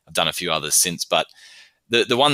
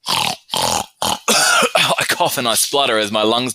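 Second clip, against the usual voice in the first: mock coughing; 5 semitones higher, 6 dB louder, much slower than usual. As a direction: cough and splutter, higher, louder and slow. Poem acts out coughing call.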